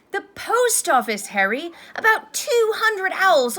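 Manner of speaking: high, loud, thrilled telling; excited gushing. A narrator's reading. Friends back from Hogsmeade telling Harry everything, excited gushing. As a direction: gush about the wonders you just saw.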